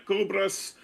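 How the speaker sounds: Kerberos opened with a hard K; hard K pronunciation. Shown as a demonstration. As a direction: say Kerberos as "KER-ber-us". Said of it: "also uses a hard K sound".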